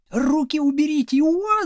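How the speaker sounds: high protesting yell; pained yell. A narrator's reading. Pupil dragged by the ear, yelling in protest. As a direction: cry out, pitch shooting up into a howl. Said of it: "заорал Градусов".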